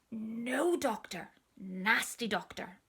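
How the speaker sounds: slow, whispered no doctor, nasty doctor; sulky child refusing. Acted. Sick little sister refusing the doctor, slow and sulky.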